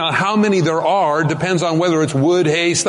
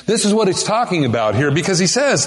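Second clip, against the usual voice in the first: normal talking voice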